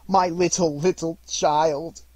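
put-on loud, high anguished wail, drawn out on "child"; acted heartbroken outburst; grief-stricken cry. A narrator's reading. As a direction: cry out as a bereaved father. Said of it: "cried Bob".

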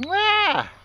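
a sudden high, loud "Ah!" at the birds; high cry to the ducks. Real, not acted. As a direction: yelp up high to catch their attention. Calling out to the ducks.

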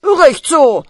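loud approving shout. Acted. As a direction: bellow it out. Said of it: "schreit der Doktor".

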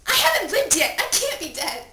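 loud, high-pitched panic; frantic protest. Acted. Dead teen begging not to be covered, frantic and loud. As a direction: cry out in desperate denial.

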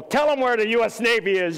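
high, loud outburst; mock exasperated yell. Grandfather filming tells oblivious dad to answer, exasperated mock yell. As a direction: blurt it out loudly, pitched well up.

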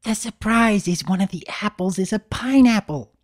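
high, playful confiding; Pinkie's secret. Acted. Pinkie leaning to Big Mac's ear, playful. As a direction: share a silly secret. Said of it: "leaned over to his ear".

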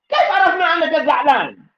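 high, loud, fast; سؤال بصوت عال وسريع; loud fast question. Shown as a demonstration. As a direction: fire the question at the crowd. Preacher asks about anger.

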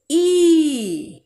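ई stretched long and loud. Shown as a demonstration. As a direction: hold ई out long. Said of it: "इसे बोलने में अधिक समय लगेगा". (लंबा खींचा हुआ ई)

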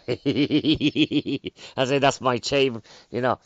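slow, laughing through the words; laughing confession. Real, not acted. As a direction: chuckle it out as an admission.

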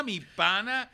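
usual speaking voice

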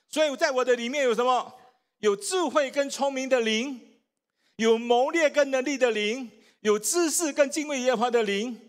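fervent proclamation to the congregation; preaching at full force. Acted. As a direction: lift the church into faith.

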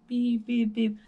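imitated alarm beeps.

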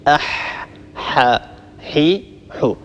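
أح، ح، حي، حو with breathy mid-throat h; slow, separate syllables أح، ح، حي، حو; ha articulation drill. Shown as a demonstration. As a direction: push voiceless air through mid-throat on each ح. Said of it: "هذا هو مخرج حرف الحاء".

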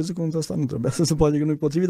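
ordinary talk

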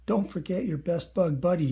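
mild farewell call, read aloud. A narrator's reading. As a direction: call it out across the clearing. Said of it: "he called".

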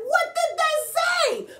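very high, slow, big-worded exclamation; excited outburst. Acted. Imitating Grover's big words to children, excited. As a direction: blurt it high and stretch every word. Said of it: "he's feeling a little excited 'cause his words are big".

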